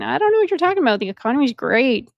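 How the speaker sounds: lightly mocking Kamala Harris, breezy and airy. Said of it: "Kamala Harris going".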